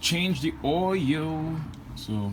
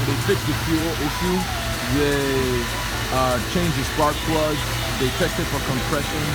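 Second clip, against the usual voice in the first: loud and thrilled; pumped, talking over the engine; excited; 2 semitones higher, 6 dB louder than usual. Real, not acted. Car fixed, excited.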